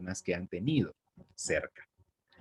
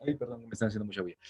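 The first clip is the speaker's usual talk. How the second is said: quick aside; 7 dB quieter, much faster than usual. Real, not acted. Interrupted by noise, quick aside.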